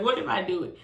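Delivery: ordinary delivery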